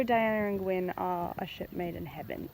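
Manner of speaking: ordinary talk, nothing marked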